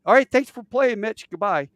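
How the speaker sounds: high, loud, breezy sign-off; radio host. Retold or imitated. Hosts ending Mitch's call, mock radio host. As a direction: send the caller off like a game show.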